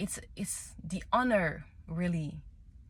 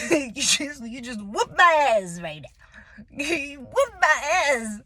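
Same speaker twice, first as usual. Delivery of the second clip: laughing; 5 semitones higher, 5 dB louder than usual. Real, not acted. Laughing at being humbled.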